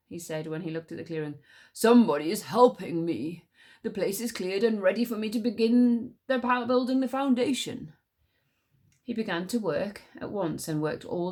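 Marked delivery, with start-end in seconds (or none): 1.7-7.9 louder, puzzled stag. Acted. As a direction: wonder aloud as the stag. Stag voice in story.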